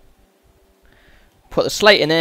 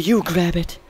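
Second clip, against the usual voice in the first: mimicking a creepy game character; weirdo voice; 5 dB quieter than usual. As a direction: put on a weird kinky voice. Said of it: "He sounds like a right weirdo".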